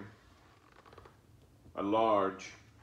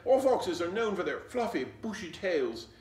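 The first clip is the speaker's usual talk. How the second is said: loud insistence; 6 semitones higher, 7 dB louder than usual. Acted. Fox insisting loudly.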